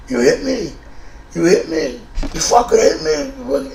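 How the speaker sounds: high, stunned, whining voice; mock dazed victim, high and pleading; dazed whiny repeat. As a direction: whine it like someone just knocked out. Dazed roommate repeating, retold.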